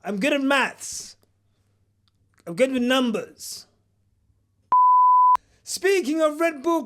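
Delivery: usual speaking voice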